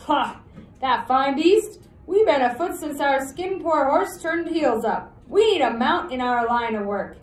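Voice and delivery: loud, high scoffing ruffian; loud outlaw voice. Acted. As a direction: sneer at the plan. Billy scoffs at horse.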